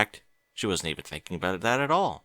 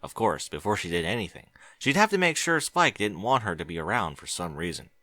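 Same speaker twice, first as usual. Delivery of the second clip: ordinary delivery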